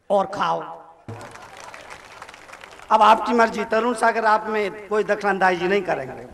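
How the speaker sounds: ordinary delivery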